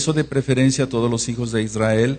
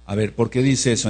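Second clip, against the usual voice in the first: ordinary talk, nothing marked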